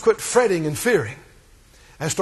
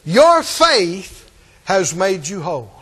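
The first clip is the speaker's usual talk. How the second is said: Your faith stretched slow and heavy, falling; stress on Your faith; 3 semitones lower, slower than usual. Shown as a demonstration. Preacher shows the congregation where Jesus put the emphasis, slow and heavy. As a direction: lean hard on "Your" and "faith", slowly. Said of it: "That's where Jesus placed the emphasis".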